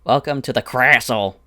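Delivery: loud, high, hammed-up character voice; terrible Beatums impression. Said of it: "My Beatums impression is terrible".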